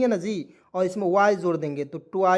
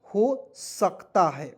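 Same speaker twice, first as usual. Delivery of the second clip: slow emphasis; much slower than usual. Shown as a demonstration. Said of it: "क्या होता है दोस्तों".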